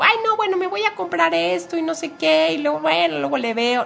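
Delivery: high, breezy, careless shopper; carefree spender voice. Acted. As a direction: toss it off like an impulse buyer. Mimicking a careless serpent spender.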